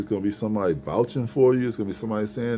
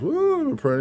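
mock child voice; slower than usual. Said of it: "I'm like".

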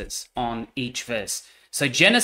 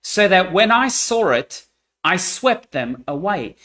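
solemn scripture reading; 6 semitones higher, 6 dB louder than usual. Acted. Reads God's words in Ezekiel.

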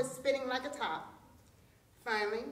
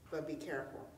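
low, soft caution from the tiger; grudging, hushed warning; wary warning; 8 semitones lower, 8 dB quieter, faster than usual. A narrator's reading. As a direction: warn quietly, voice dropped. Tiger warily gives in.

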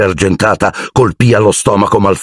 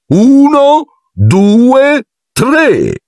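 high, louder ringing count; loud countdown; 3 dB louder, much slower than usual. A narrator's reading. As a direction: call the count like a referee. Lockhart counts off duel. (conto alla rovescia gridato)

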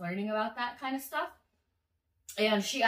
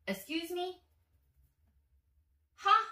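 mock disbelief; 8 semitones higher than usual.

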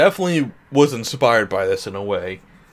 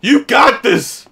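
cheering; 11 semitones higher, 8 dB louder, slower than usual. Real, not acted. Cheering on Pooh during movie.

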